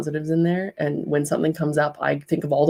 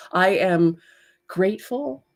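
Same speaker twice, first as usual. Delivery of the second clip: ordinary talk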